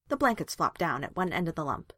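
plain reading voice

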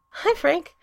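nervous greeting trying to sound charming; shrill and out of breath. A narrator's reading. Vampire greeting his date at the door, high and breathless. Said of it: "sounding shrill and slightly out of breath".

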